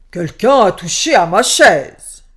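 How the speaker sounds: booming loud bear roar; big bear shout. A narrator's reading. Big bear finding his chair moved, loud booming shout. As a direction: bellow it like a huge angry bear. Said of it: "le grand ours cria d'une grande voix".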